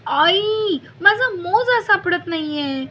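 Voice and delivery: high whining call to mother; whiny call. A narrator's reading. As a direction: call out for help, complaining. Sister calls mother.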